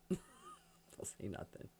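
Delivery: mock hush. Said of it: "you look at somebody and you go".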